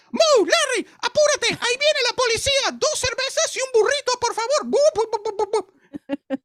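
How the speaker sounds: very high, loud squealing clown in Spanish; Three Stooges in Spanish. Acted. Comedian shows slapstick show dubbed, very high Spanish clown. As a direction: squeal frantic Spanish slapstick, ending in boops. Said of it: "what it sounded like in Spanish".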